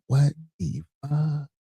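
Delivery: very slow, hushed; slow stunned disbelief. Real, not acted. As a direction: stretch it out in shock. Reacting to headline.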